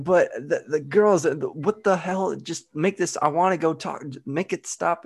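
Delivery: high-pitched, stammering mock panic; frantic pleading. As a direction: plead helplessly in a high voice, tripping over words. Retold frantic plea to hypnotist.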